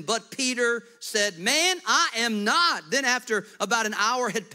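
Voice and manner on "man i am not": high, loud, slow denial; Peter's denial. Acted. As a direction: insist on it. Peter voiced denying.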